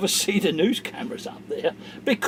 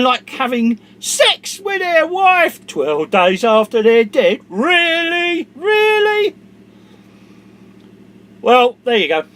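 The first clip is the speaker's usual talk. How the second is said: much louder, much higher, slowed insults; furious rant; 5 semitones higher, 11 dB louder, slower than usual. Real, not acted. Vlogger rages at the camera, full furious shout. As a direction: spit every insult out. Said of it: "because I'm so pissed off".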